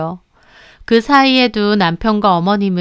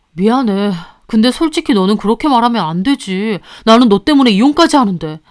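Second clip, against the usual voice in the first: lightly voiced husband, wounded; hurt husband. Husband reproaches the mistress, hurt. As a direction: protest that he gave up everything.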